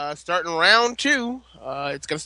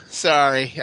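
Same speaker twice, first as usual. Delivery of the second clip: ordinary delivery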